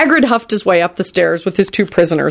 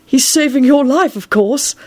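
beaming Dumbledore; 7 semitones higher than usual. A narrator's reading. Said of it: "Dumbledore beamed at him".

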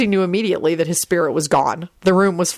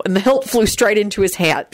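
plain reading voice

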